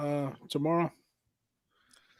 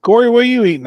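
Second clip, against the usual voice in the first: calling out; 12 semitones higher, 11 dB louder, much faster than usual. Real, not acted. Calling out across the room.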